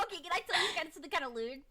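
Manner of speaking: normal talking voice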